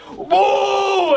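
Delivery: drawn-out high "woo" of an attack; imitated whoosh, shouted high; mock battle cry; high attack whoop. Imitates Akainu launching his attack.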